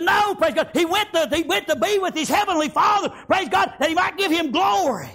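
shouted preaching. Acted. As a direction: proclaim it like a fervent grandfather. Grandfather preaching to grandson.

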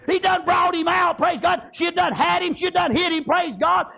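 high, driving shouted preaching; impassioned preacher's cry; fervent preaching. Real, not acted. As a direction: pile it up with revival fervor. Preaches at full force.

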